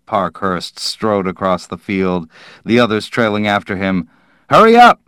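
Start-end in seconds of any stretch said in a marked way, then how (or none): 4.5-5.0 much higher, loud call. Acted. Parkhurst yelling back to the men trailing behind, loud. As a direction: yell back to the stragglers.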